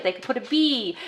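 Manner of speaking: normal talking voice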